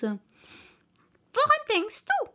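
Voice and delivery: high, slow reproachful question; drawn-out, high-pitched scolding question; stern mouse. A narrator's reading. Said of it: "sagte die Maus streng".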